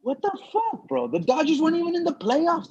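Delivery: high-pitched baffled protest; retold bewilderment, voice pitched right up; indignant disbelief. Comic reacting to the host's Dodger jab, high indignant disbelief. Indignant inner reply to host.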